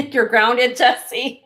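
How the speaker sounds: "You're grounded" scolded in jest through a laugh; laughing mock scold. Teasing a friend who joined.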